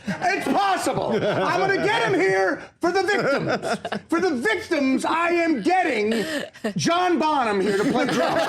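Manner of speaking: mock tantrum, very high and drawn out; hammed-up bigwig yelling, stretching the words; Dolan. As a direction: grandstand at full pitch, refusing to hear no. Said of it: "Dolan literally going".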